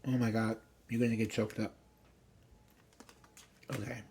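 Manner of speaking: usual speaking voice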